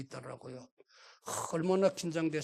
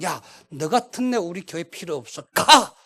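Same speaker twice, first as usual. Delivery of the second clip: a sharp, much louder shout on 가; angry dismissal; 8 dB louder than usual. Retold or imitated. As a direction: throw him out of the church with a yell. Imagined pastor throwing him out.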